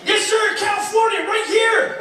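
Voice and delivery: high, loud hometown shout-out; mock cheering American. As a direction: holler it like an overexcited American fan. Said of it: "They're whooping and cheering".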